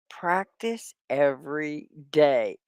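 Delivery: slow, careful classroom model; slow and clear. Shown as a demonstration. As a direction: spell it out slowly for learners. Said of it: "Say it with me".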